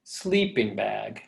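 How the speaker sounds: "Sleeping bag" stressed on "Slee", then dropping; dactyl stress. Shown as a demonstration. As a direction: draw out "Sleeping bag", weight up front. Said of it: "stressed and too unstressed".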